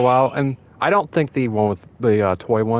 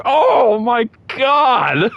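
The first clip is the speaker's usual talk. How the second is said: much higher, very slow, shouted over laughter; shouted disbelief; 5 dB louder, much slower than usual. Real, not acted. Podcaster losing it at the tweet, shouting. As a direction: yell it in delighted shock.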